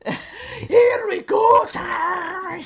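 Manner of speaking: loud, high laughing shout; laughing battle shout. Acted. Excited battle cry.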